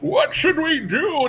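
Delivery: very high, slow, drawn-out wail; absurdly stretched character impression; ridiculous cartoon voice. Imitating the character answering the panicked actors, high and ridiculously slow. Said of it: "his voice is so ridiculous".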